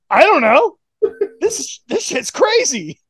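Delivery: hammed-up stunned braggart; mock cocky wrestler. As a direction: play it as a wrestler who can't believe his own luck. Silver shrugging off a fluke win.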